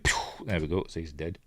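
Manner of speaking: ordinary delivery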